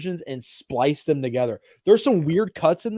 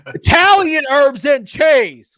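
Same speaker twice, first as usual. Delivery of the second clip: loud, slow and higher, every syllable spelled out; loud slow over-enunciation; 10 semitones higher, 11 dB louder, slower than usual. Retold or imitated. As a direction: shout the order at someone you think can't understand. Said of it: "start talking to them loud".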